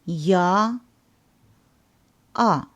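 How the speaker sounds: Ya as in yard, then A as in father; Russian ya sound. Shown as a demonstration. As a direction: glide in with a y, then an open ah. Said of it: "Please repeat".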